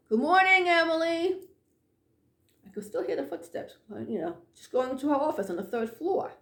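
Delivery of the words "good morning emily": calling out upstairs. Retold or imitated. To a coworker she can't see, calling out a greeting. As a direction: call it up to a coworker on the floor above. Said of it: "and I'm yelling out".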